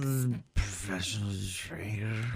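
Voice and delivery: put-on low, soft slurred drunk voice. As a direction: slur it like a drunk. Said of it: "let me hear you talk like a drunk".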